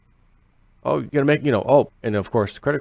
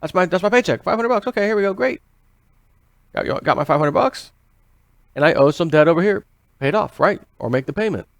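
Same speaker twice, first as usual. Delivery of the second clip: cheerful worker; 5 semitones higher than usual. Retold or imitated. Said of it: "You got a paycheck today".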